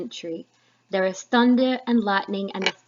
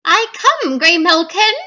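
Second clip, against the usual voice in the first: very high, very loud witch cry; loud high hag call; 9 semitones higher, 12 dB louder than usual. Acted. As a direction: shriek out to the cat familiar. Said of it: "introduce you to the three witches".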